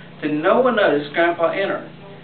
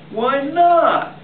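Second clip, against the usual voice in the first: innocent question; 10 semitones higher, 3 dB louder than usual. Acted. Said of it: "he asked".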